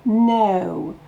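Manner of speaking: "No" gliding from e to o; e to o glide. Shown as a demonstration. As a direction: slide from e into o in "No". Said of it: "on part du E, on va vers le O". ("No" qui glisse du E vers le O)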